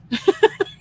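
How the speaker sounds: real, amused outburst; high, loud laugh. Real, not acted. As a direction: burst of high-pitched laughter. Laughs after retelling her shock.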